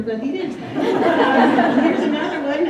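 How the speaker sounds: amused laugh carrying into the line. Real, not acted. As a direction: chuckle while announcing the next slide. Laughs while moving to slide.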